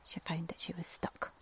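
plain reading voice

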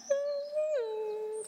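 high sleepy hum; dreamy hum. Acted. Sleeping baby bird, high dreamy hum. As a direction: hum like a dozing chick.

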